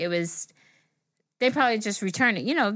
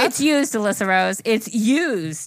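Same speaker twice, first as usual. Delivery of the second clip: louder, emphatic disbelief; outraged exclamation; 3 dB louder, slower than usual. Retold or imitated. Telling a friend the product came used, outraged. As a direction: hammer home the awful news.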